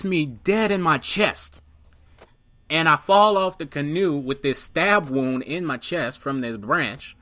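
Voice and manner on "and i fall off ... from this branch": usual speaking voice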